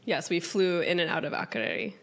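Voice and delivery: normal talking voice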